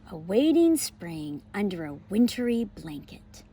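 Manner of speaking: lively, expressive picture-book read-aloud; reading to children. Acted. Teacher reading a pumpkin book to her class, bright and expressive. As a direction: read it like story time for little kids.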